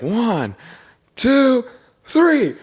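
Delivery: high, loud countdown; retold countdown shout. Jump crew counting off at the plane door, shouting.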